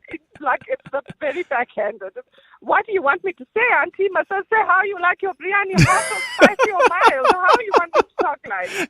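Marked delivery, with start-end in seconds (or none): 2.6-8.9 high, mocking comeback to the condescending auntie; sarcastic retort. Caller retelling her comeback to the auntie, mocking and pitched high. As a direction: throw the question back at her, higher and sharper.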